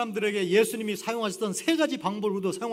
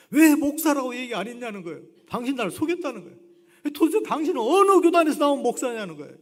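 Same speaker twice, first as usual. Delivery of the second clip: loud, higher, rising anger, a bit fast; angry rant; 7 semitones higher, 10 dB louder than usual. Retold or imitated. Harry yells at the pastor who fooled him, angry shout. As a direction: accuse him, building to a shout. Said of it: "해리가 막 화를 내요".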